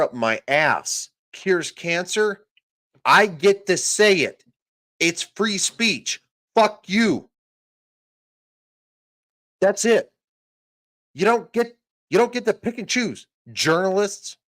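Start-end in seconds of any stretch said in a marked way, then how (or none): 3.0-12.4 high, loud fury; angry shout. Real, not acted. Defending free speech, shouting angrily. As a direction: yell it at them.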